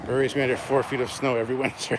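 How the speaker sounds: loud, laughing complaint; laughing rant. Real, not acted. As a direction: gripe about the snow with a laugh. Ranting about lake snow.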